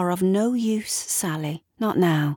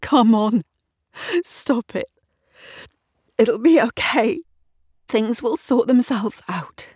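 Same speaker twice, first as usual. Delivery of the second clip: higher, agitated, forcing herself together; angry self-talk; 7 semitones higher, 4 dB louder than usual. A narrator's reading. Woman trying to collect herself at the mirror, angry and strained. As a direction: scold yourself through rising panic. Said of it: "she says to herself angrily".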